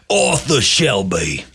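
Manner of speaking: slow, thick Brummie gangster voice; drawn-out Peaky Blinders impression; Arthur Shelby. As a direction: announce the name in a hammed-up Birmingham accent. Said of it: "I'm mimicking him out loud".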